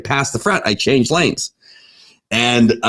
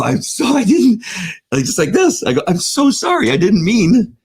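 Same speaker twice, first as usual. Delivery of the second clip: groveling apology; 9 semitones higher than usual. Retold or imitated. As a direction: beg for forgiveness, pitched up.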